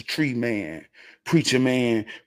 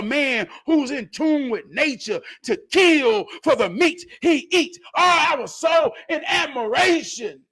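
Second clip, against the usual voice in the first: excited shout; 12 semitones higher than usual. Real, not acted. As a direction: shout your admiration out. Raving about Junebug's hunting.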